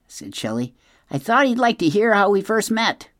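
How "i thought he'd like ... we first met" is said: high, louder otter explaining; raised-pitch animal character, meaning well; apologetic otter. A narrator's reading. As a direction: protest innocently as a chatty otter. Otter defends telling the story.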